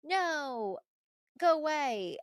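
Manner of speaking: mock indignant send-off; Hugh. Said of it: "and then Hugh is like".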